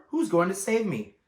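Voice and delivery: high, loud desperate question; eager plea. Acted. Wilbur urgently asking Charlotte who will save him. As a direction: ask it breathless with hope.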